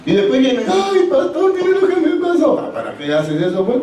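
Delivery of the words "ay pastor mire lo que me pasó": high-pitched, put-on plaintive voice; hammed-up woe, pitched well up; mock lamenting churchgoer. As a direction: imitate a believer wailing to the pastor. Mimics believers returning to complain.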